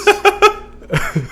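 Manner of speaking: a burst of high laughter; loud high laugh. Real, not acted. Host cracking up at his cohost's quip, loud high laugh. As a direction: crack up loudly.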